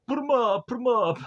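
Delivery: put-on cartoonish fighting talk; Dan Aykroyd. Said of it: "we again have Dan Aykroyd's like".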